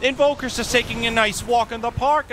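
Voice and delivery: exasperated caster. Real, not acted. Caster exasperated at repeat sentry.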